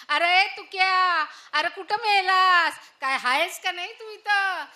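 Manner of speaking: calling out. Acted. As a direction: yell for the son from across the house. Calls son Tukya loudly. (मुलाला मोठ्याने हाक)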